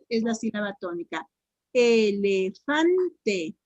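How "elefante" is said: "Elefante" in slow syllables, FAN punched. Shown as a demonstration. Teacher quizzing pupils on the stress, "Elefante" slowly split with FAN stressed. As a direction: stretch "Elefante" out and hit FAN. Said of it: "la pronuncien por sílabas despacito".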